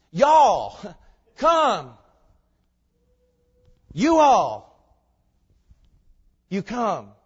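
loud, high commands, spaced apart; booming, insistent summons; exceedingly emphatic. Shown as a demonstration. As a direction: punch each word as a separate order. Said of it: "he's exceedingly emphatic".

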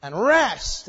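loud, high, slow proclamation; drawn-out, forceful royal command. Acted. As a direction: summon them as a king would, at full voice. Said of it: "He doesn't make it a suggestion".

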